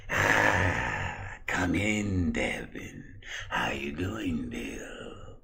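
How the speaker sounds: hushed, whispery sinister greeting; eerie breath-heavy doctor; creepy doctor voice. Acted. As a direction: draw them in with a creepy near-whisper. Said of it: "why do you talk like that".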